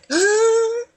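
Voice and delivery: high, loud mock gasp of shock; retold gasp. Actress retells being hugged at callbacks, a high loud gasp. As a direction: gasp as if overwhelmed. And I'm like.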